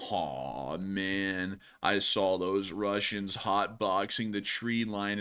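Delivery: low, slow, lazy stoner drawl; deep and dragged out, like a stoner. Pretend. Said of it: "that stoner voice you like to do".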